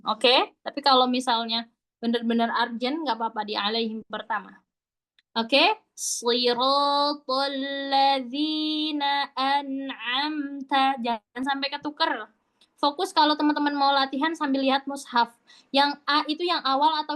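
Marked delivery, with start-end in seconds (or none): 5.9-11.0 slow, sustained tartil; drawn-out model recitation; Quranic recitation. Shown as a demonstration. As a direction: chant it slowly with evenly held syllables. Teacher demonstrates slow recitation.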